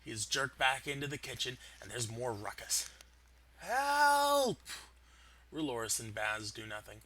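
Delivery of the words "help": much higher, louder desperate shout; cry for help. Acted. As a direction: yell for rescue from the kitchen. Chef cries from kitchen.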